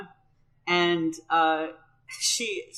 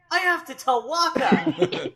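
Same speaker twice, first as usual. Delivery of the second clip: much higher, slow, laughing blurt; imitating Titus; 11 semitones higher, slower than usual. Titus immediately blurting Waka must be told, high mock excitement. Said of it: "Titus immediately goes".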